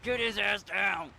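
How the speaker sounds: loud, drawn-out yell; game-time shout. Real, not acted. Streamer yelling at the football game, drawn-out shout. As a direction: shout it at the players, stretching the words.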